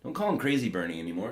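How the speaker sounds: normal talking voice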